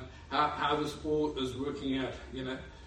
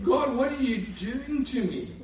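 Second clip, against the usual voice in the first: mock alarmed plea; 6 semitones higher, 4 dB louder, slower than usual. Moses alarmed at leprosy.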